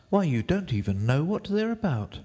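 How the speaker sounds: put-on high-pitched defiant girl; Alice. A narrator's reading. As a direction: refuse in a high girl's voice. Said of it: "said Alice".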